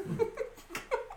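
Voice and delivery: high breathless burst of laughter; shrieking laugh. Real, not acted. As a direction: let the laugh break out in a squeal. Laughing after shocked outburst.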